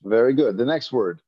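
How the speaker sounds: usual speaking voice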